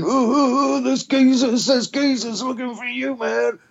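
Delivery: high, excited yelp of a panicking friend; panicked warning. Retold or imitated. Friend bursting into the pub with a high panicked warning. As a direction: squeal the warning high and breathless.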